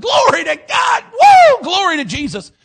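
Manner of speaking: high, loud whoop of praise; jubilant shouting. Acted. Preacher acts out David praising before the Ark, shouting. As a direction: shout praise at full voice, pitch leaping up. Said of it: "leaping and shouting and".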